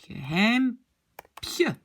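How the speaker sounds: geheimpieu in slow, separated parts; slow sounding-out. Shown as a demonstration. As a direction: stretch out "geheimpieu" for learners. Teacher sounds out the new form. (geheimpieu, lentement, en détachant les syllabes)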